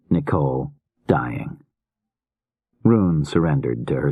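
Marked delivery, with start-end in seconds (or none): none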